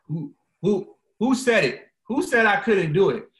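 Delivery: high, loud and defiant, daring someone to answer; defiant challenge. Retold or imitated. Challenging anyone who says he can't talk like this, loud and defiant. Challenging doubters of his speech.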